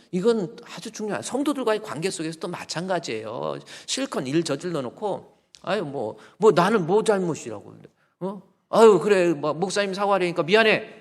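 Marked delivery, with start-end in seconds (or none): 5.6-10.9 louder, higher mock huff of a half-hearted sorry; mock a sulky member who only apologizes because he has to; hammed-up begrudging sorry to the pastor; grudging apology.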